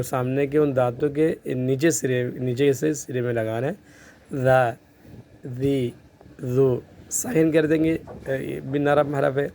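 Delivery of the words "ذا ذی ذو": ذا ذی ذو with a soft th, tongue between the teeth; no hiss in ذا; soft dhal. Shown as a demonstration. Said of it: "یہ ذال نرم حروف میں".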